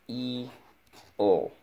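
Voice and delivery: F-E-O as high, held separate sounds; sounding out. Shown as a demonstration. Teacher repeats feel's sounds for pupil, sounding out. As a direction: draw out each sound in F-E-O. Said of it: "Let's go".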